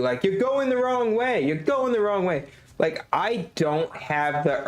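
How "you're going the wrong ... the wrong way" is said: mock nagging yell. Streamer imitating the game that keeps yelling at players, mock yell. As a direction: mimic repeated yelling, pitched up at normal volume. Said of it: "the game keeps yelling at you".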